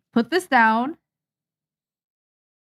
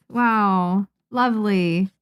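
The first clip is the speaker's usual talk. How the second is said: usual speaking voice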